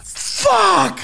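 high, slow shouted protest through laughter; laughing shout. Retold or imitated. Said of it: "I was like".

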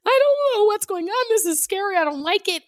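put-on high, frightened little-boy wail, easing down; scared crying toddler. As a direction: imitate a baby terrified in the pool. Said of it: "he cried with, like, fear of".

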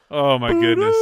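loud high laughing exclaim; laughing outburst. Real, not acted. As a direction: burst out laughing.

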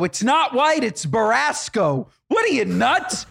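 high, slow indignant insistence; indignant correction. Retold or imitated. Telling the coordinator off about the car colour, high indignant. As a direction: correct her with mock outrage. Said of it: "swear to God, I go".

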